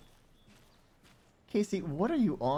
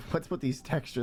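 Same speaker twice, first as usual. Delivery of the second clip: ordinary delivery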